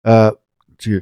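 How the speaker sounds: ordinary delivery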